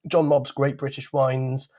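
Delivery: normal talking voice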